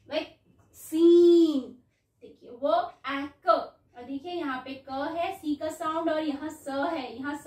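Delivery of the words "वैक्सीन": वैक्सीन with a loud, very long ee in the last syllable. Shown as a demonstration. Teacher pointing out the long ee, वैक्सीन loudly stretched on its ending. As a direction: draw out the ee of वैक्सीन slowly. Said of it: "यहाँ पे बड़ी ई".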